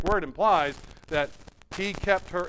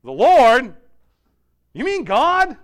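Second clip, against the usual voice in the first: astonished; 8 semitones higher, 13 dB louder, slower than usual. Acted. Jack astonished.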